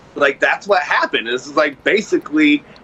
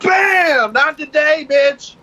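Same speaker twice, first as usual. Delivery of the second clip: triumphant shout; 11 semitones higher, slower than usual. Retold or imitated. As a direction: shout it like landing the knockout blow. Acted fight climax, shouted.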